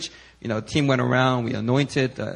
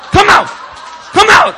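much higher and louder, shouting commands at the demon; commanding shout; 11 dB louder, much slower than usual. Retold or imitated. Pastor Stephen yelling at the demon, fierce and much louder. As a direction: roar it like an old-school deliverance preacher.

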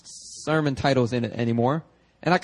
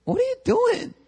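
high, baffled exclamation at a backslider; exasperated disbelief, pitched way up; acting out a baffled pastor; incredulous mock outburst; 9 semitones higher than usual. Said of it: "And you're like".

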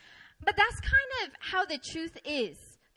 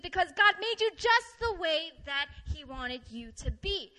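normal talking voice

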